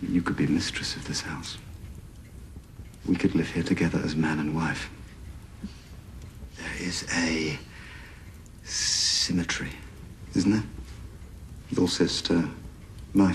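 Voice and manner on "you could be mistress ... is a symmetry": seductive whisper. Acted. Suitor proposing intimately.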